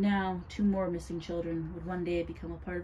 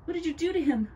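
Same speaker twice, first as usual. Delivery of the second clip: high, loud outraged question; alarmed accusation; 10 semitones higher, 6 dB louder, faster than usual. A narrator's reading. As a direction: confront her in alarm. Girl accuses witch of poisoning.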